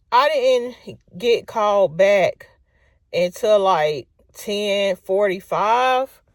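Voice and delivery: slow, drawn-out complaint; long weary drawl; dragged out slowly. Real, not acted. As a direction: stretch every word of the wait. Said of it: "stretching the long wait".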